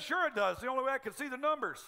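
a husband protesting with a grin; playful defensive retort; husband's comeback. Retold or imitated.

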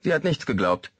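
ordinary delivery

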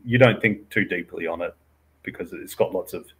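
ordinary talk, nothing marked

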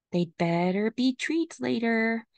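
ordinary delivery

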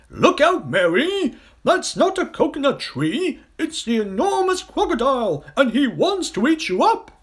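high, loud hippo warning; urgent shout. A narrator's reading. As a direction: bellow the danger at the girl. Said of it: "shouted Humpy Rumpy".

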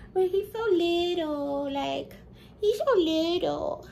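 high pet voice about the tiny kitten. Real, not acted. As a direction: coo over how tiny he is.

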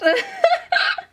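very high, tearful squeal of laughter; real overwhelmed cackle of joy; joyful laugh. Real, not acted. Overjoyed at clear line.

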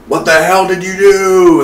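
retold angry yell. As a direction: imitate the captor yelling at the intruder. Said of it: "John Goodman was yelling".

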